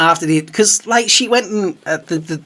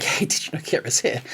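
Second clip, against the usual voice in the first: much softer, half-whispered tip; confidential whisper; 8 dB quieter than usual. Pretend. Planning to quietly tell Sharon the star is here, hushed. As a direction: tip her off in a hush.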